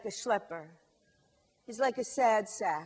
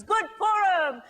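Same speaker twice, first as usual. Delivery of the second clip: cheerful greeting; 6 semitones higher, 6 dB louder than usual. Acted. Greets grocer.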